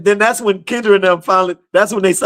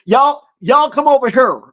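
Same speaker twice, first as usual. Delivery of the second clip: Southern drawl; 8 semitones higher than usual. Retold or imitated. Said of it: "Sherry talks Southern".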